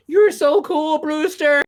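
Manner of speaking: high-pitched movie-line impression; Stephen Jeffries. Film fan quoting a favourite line, high-pitched impression. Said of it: "Stephen Jeffries, of course, with".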